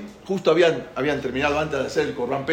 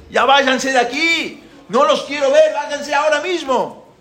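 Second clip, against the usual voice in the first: acted shouting; 10 semitones higher, 5 dB louder than usual. Pharaoh orders Moshe's people to leave now, shouting. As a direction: shout them out of the place. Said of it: "¿Por qué grito?".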